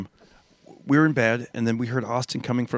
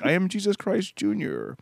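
imitating a kid's higher-pitched proud declaration; mock-grand child's correction, pitched up; Jesus Christ; 6 semitones higher than usual. Dad voicing son insisting on his Jesus role, grandly.

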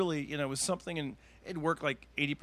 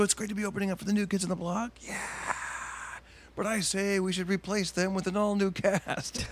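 higher, bright then slowed pitch; eager stage pitch; 8 semitones higher than usual. Retold or imitated. Teen comic pitches his joke to New Kids fans, higher. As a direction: sell the lineup to the crowd. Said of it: "I go".

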